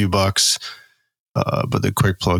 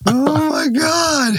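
stunned oh my God; much slower than usual. Real, not acted. Disbelief at the chili joke.

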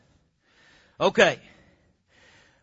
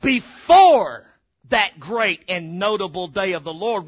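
"before" loud and set apart, the rest lower; stress on before; 3 semitones lower, 5 dB louder, slower than usual. Shown as a demonstration. Preacher repeating the sister's verse, booming stress on "before". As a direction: boom out "before", pause, then drop down. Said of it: "put some emphasis on that word before".

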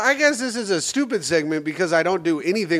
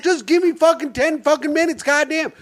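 exasperated retort; 9 semitones higher than usual. Retold or imitated. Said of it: "I'm like".

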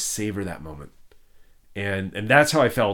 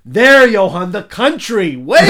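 high, loud call; 10 semitones higher, 13 dB louder, slower than usual. Retold or imitated. Crew member pointing out Wales to Johan, high loud call. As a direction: shout the punchline. Crew correcting him, calling out.

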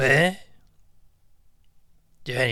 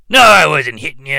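ordinary delivery

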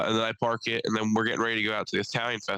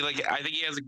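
ordinary talk

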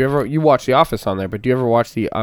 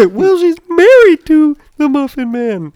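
high, loud squeaky cookie voice; gingerbread man voice; 9 dB louder, slower than usual. Retold or imitated. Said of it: "he sounds more like the gingerbread man".

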